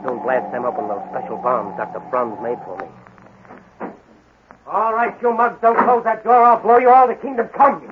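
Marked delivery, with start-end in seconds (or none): 4.7-7.8 much higher, louder threatening yell; threatening shout. Acted. Hero bursting in on the gang, shouting a threat. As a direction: warn them off with full force.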